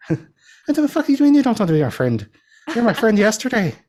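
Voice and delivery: Irish brogue, outraged and shrill with disbelief; mock Irish accent. Friend dumped in an Irish film, hammed-up Irish accent. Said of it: "Colin Farrell's, like, my Irish accent inspo".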